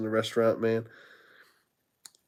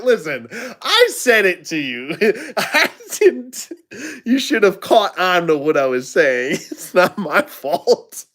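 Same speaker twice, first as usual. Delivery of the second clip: laughing defence; 6 semitones higher than usual. Retold or imitated. Laughing self-defence quote.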